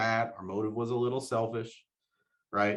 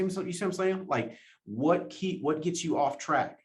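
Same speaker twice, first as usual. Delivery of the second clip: ordinary talk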